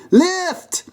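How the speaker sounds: very high-pitched shout; high, effortful order; straining command. Acted. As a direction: call the heave, voice leaping up. Cop calling the heave.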